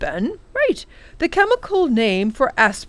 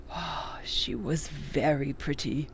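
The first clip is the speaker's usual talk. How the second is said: softly whispered, slow and fond; tender whisper; 5 semitones lower, 8 dB quieter, slower than usual. Acted. Old professor recalling his late wife, slow tender whisper. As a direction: breathe it out, lost in memory of her.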